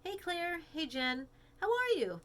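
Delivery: put-on high, bright-eyed girl voice; Peach voice. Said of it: "so Peach".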